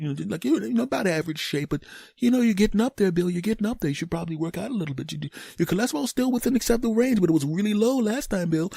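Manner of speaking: high, hesitant doctor voice; cautious doctor. Retold or imitated. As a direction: break bad news gently to Bill. Doctor gently warning Bill.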